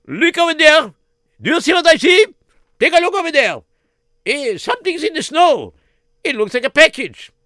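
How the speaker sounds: high, loud excited soldier calling. Acted. Soldier calling out to the king about the snow, high and loud. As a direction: shout it out like an eager young soldier.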